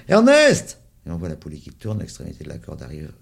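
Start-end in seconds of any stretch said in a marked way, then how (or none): 0.1-0.8 high, loud shout of the name; cry out. A narrator's reading. Boy at the well, crying out the name. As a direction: yell his name down the well. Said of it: "Il lève les yeux et crie".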